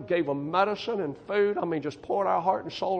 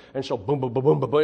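mock drumbeat; 5 semitones lower, 5 dB louder, faster than usual. Imitating the disco beat.

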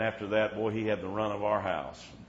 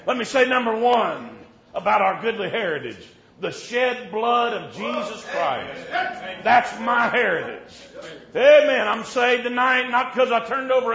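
high, loud revival shout; preaching at full force; 13 semitones higher, 16 dB louder than usual. Acted. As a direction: drive it home to the congregation.

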